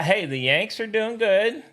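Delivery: ordinary delivery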